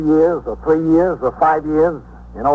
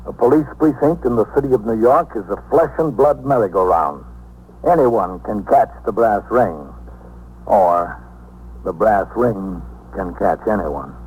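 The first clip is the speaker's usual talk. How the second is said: closing narration; 3 semitones lower than usual. A narrator's reading. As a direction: let each phrase land with weight.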